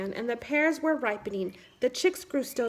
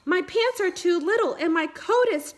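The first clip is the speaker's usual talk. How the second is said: proud announcement; 7 semitones higher, 4 dB louder than usual. Acted. Boy tells animals proudly.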